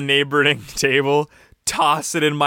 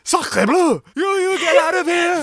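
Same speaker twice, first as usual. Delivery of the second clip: outraged French chef voice, much higher. Acted. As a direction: shout it like an angry French chef. Restaurant scene, angry French waiter.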